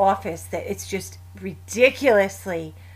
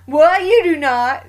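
higher and louder, an imitated son in disbelief; mock disbelief; 8 semitones higher, 9 dB louder, much slower than usual. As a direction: blurt it like you can't believe it. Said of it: "And he'll be like".

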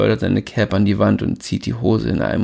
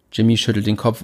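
ordinary delivery

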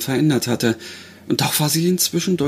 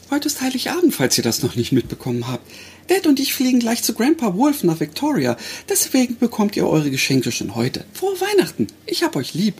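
higher, bright young daughter voicing; young girl voice; 9 semitones higher than usual. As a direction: play the young girl gently. Grace talks at the grave.

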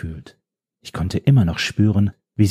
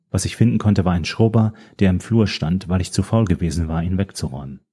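usual speaking voice

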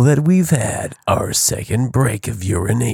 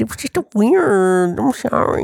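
whiny mock voice; 8 semitones higher, slower than usual.

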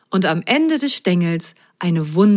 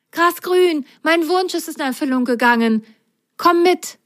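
excited call; 10 semitones higher than usual. Acted. Rosenrot calls Grasgrün to come, high and eager. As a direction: call your friend along happily.

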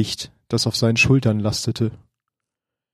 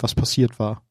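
ordinary delivery

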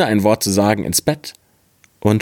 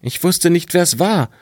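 put-on much higher, innocent boy pleading his case; protesting boy; 9 semitones higher than usual. Boy insists to his scolding father he is innocent, high pleading voice. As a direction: pitch way up, the wide-eyed innocent boy.

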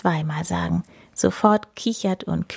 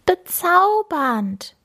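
high, very slow sing-song; fluting; 10 semitones higher, much slower than usual. Acted. Tuki praising Paul, high slow fluting voice. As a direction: flute it sweetly. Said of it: "flötet Tuki".

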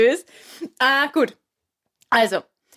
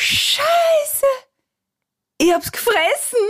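high, squeaky horrified cry; shocked punchline; much slower than usual. Acted. Man in the joke realizing the worst, high-pitched horror. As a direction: shriek the punchline in disbelief. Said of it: "denkt sich".

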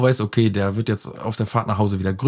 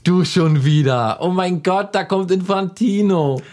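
Infantino voice; 6 semitones higher, slower than usual. Pretend. Announcing the character.

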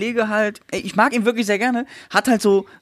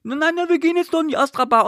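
higher, whiny imitation of his insistence; mock guy; 8 semitones higher than usual. Mocking the guy overruling her no, higher whine. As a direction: parody his stubborn comeback. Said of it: "du sagst ihm, nein".

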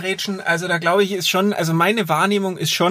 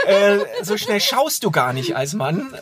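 normal talking voice

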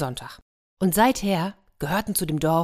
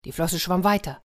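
usual speaking voice

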